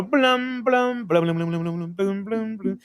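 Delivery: high, sung "blum" piano notes; imitated romantic piano. Host mimics the romantic piano after a kiss. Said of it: "the romantic piano".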